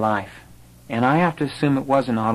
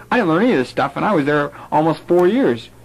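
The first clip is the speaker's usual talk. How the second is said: incredulous protest; 5 semitones higher than usual. Retold or imitated. Retold own incredulous protest.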